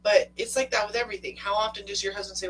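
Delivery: normal talking voice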